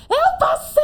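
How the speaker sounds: ecstatic high shout; screaming with joy. Retold or imitated. Seeing her name on the approved list, joyful scream. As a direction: scream the news at the top of your voice. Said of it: "Aí eu comecei a gritar".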